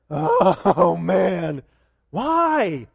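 loud drawn-out groan on "Oh", then a whiny "why"; mock groan. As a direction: groan it out like a reluctant disciple. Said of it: "Maybe the disciples went".

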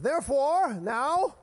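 loud, high, booming preacher delivery; good preacher's accent. Acted. Pastor playfully imitates a good preacher, booming accented voice. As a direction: belt it out like a hammed-up preacher. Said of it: "I don't know what accent he had".

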